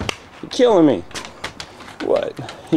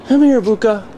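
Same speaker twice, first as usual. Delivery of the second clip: higher, louder call; calling the dog; 9 semitones higher, 4 dB louder than usual. Real, not acted. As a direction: call the dog across the room.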